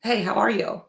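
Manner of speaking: ordinary "how are you", lead on HEY; neutral reading. Shown as a demonstration. Friend showing how a text could be read, neutral greeting. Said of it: "it could be read like".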